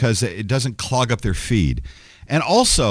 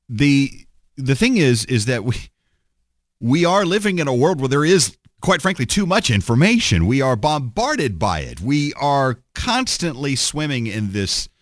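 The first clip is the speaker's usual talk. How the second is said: usual speaking voice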